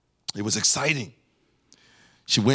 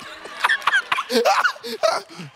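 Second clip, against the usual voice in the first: high-pitched laugh. Real, not acted. Laughs at own joke.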